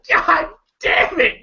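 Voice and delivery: high, slow shocked cry; shocked exclamation. Retold or imitated. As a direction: gasp it out in disbelief.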